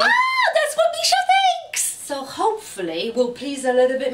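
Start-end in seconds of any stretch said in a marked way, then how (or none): 0.0-1.8 high delighted shout; excited shout. Real, not acted. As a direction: squeal it out with glee. Mum teasing daughter.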